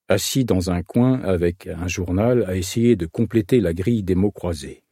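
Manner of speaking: ordinary delivery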